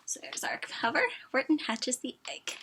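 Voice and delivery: whisper. Real, not acted. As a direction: breathe the words softly. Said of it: "unvoiced whisper".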